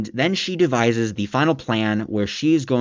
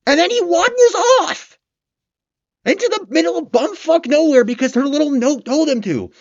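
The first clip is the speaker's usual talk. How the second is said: much higher, louder, incredulous shrieking rant; exasperated rant; 4 dB louder than usual. Real, not acted. Reviewer venting at the careless villain, exasperated high shriek. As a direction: squeal in disbelief at how dumb he is. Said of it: "if you couldn't tell based on my animation".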